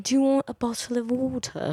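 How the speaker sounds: high-pitched English accent; Harry Potter English. Shown as a demonstration. Correcting the co-host's attempt, high Harry Potter English accent. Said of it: "that's the Harry Potter English".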